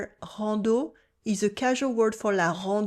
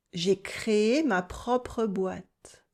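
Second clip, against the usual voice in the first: slow repeat; 3 dB quieter, much slower than usual. Shown as a demonstration. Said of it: "repeat after me".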